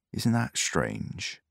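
a slow, quiet mutter. A narrator's reading. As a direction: mutter it slowly to yourself. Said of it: "muttered the man".

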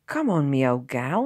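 loud, hearty urging; delighted yell. A narrator's reading. Driver urging the horse homeward, delighted yell. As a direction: yell happily to get going. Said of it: "he yelled in delight".

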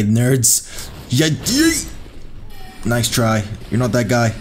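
ordinary talk, nothing marked

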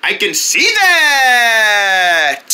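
very slow, long-held last word; drawn-out reply. Acted. Friend answering Joe's rant, comically drawn out. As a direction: hold the final word and let it slide down.

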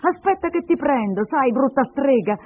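high, loud, drawn-out warning; scolding threat. Acted. Little woman makes to slap the girl, scolding. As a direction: threaten to catch the naughty child. Neighbour threatens the naughty child.

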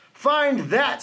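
a taunting challenge with a touch of scorn; sneering. A narrator's reading. Bird taunts the searching elephant, lightly sneering. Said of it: "sneered the bird".

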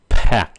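"pack" with a loud burst of air on P; popped aspirated P. Shown as a demonstration. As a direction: pop the P of "pack" hard. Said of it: "một cái là nổi bật ra". (bật hơi mạnh chữ P trong "pack")

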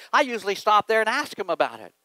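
ordinary delivery

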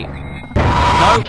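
startled unvoiced yelp of fright; scared shout. Real, not acted. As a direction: caught off guard, cry out. Jump scare, caught.

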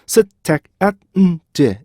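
셋, 택, 앳, 음, 제 as five separate beats; staccato syllable-by-syllable demo; syllables split apart. Shown as a demonstration. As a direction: break it into five evenly timed syllables. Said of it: "이렇게 읽으셔야 되는데".

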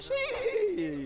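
squeaky high seahorse voice; a strange high seahorse squeak. Real, not acted. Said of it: "I sound like a seahorse".

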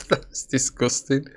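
high giggle then a disgusted laugh. Real, not acted. Player laughing at his own awful chess move. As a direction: laugh it off in disbelief. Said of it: "but I am laughing 'cause that was so awful".

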